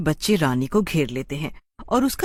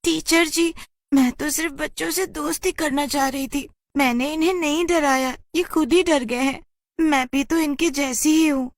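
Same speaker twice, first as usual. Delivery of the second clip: put-on crying girl; 9 semitones higher than usual. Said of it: "रोते-रोते कहती है".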